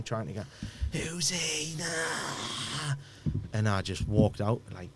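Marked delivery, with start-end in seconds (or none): 0.9-2.9 higher, softer mock scream of fear; imitating her panicked scream. Mum flips at her son in the care home, mock frightened cry. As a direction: echo a frightened mother who no longer knows her son.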